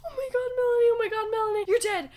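high-pitched mock alarm; imitated panic. Sister finding her sibling playing dead, imitated panic. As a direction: play a frantic sister, lightly exaggerated.

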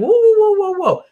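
high, loud startled whoa, pulling back; cautious colleague halting a risky plan, alarmed. Retold or imitated. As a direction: slam the brakes on a risky idea. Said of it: "You're like".

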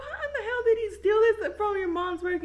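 high, fast, loud disbelieving shout; incredulous shout. Retold or imitated. Girlfriend yelling at boyfriend over the stolen pet, incredulous shout. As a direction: yell at him in disbelief.

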